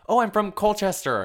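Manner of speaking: high, loud cheerful mock line; imitating herself. Speaker naming her small hometown to strangers, high and chirpy. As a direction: say it brightly, expecting recognition. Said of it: "because I'm like".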